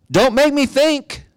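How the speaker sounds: very high, drawn-out mock whine; exaggerated mock complaint. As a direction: stretch out the protest in a high, put-on voice. Mock protest repeated, far more exaggerated.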